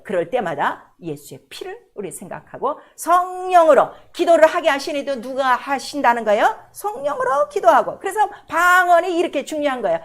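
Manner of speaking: loud, fervent preaching; preaching at full force. Acted. Pastor preaching hard to the congregation, loud and fervent. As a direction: drive it home to the congregation.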